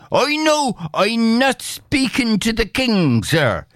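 high-pitched, bold Irish tenant; defiant peasant Bill. A narrator's reading. Bill standing up to the agent, defiant. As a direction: answer back high and bold.

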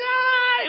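high loud shriek of panic; class scream. Retold or imitated. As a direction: scream as everyone bolts. Class jumps from their seats.

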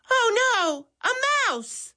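mock terror of a mouse, way up high; woman panicking at a mouse, high scared cry; frightened scream. A narrator's reading. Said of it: "Missus Baker screamed".

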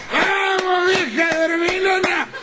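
put-on high, shouted drunken call in the street; drunk shouting. Drunk friend yelling at the house at four a.m., high shout. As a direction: holler up at the house like a happy drunk. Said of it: "a lo grito".

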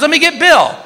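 high calling voice; wife calling his name. Retold or imitated. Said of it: "She goes".

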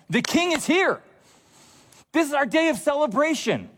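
high-pitched mock jubilant announcement; celebrating crowd. Preacher voicing the parade crowd's joy, high and celebratory. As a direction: imitate a crowd hailing its king. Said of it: "like, a Fourth of July type parade".

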